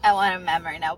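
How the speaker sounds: usual speaking voice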